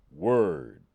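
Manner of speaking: loud, low "Word" with arms folded; mock-hip "Word", loud; cool guy "word". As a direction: bark "Word" like a street-cool dude. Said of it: "saying word out loud and folding my arms".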